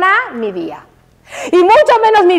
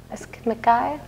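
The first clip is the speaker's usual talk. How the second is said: low, much softer catty mutter under the breath; muttered dislike; 4 semitones lower, 11 dB quieter than usual. Retold or imitated. A woman mutters about someone she dislikes, low and catty. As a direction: grumble it behind her back.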